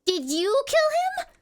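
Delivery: loud, high, drawn-out question; slow, high-pitched interrogation outburst; dramatic accusation. Acted. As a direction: accuse the suspect in a rising, over-the-top cry. Acts out accusing a suspect.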